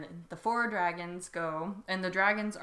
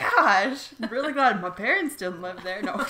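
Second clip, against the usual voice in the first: laughing; 5 semitones higher, 9 dB louder than usual. Real, not acted. Laughing at kids sacrificed.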